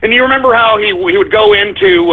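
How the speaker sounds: usual speaking voice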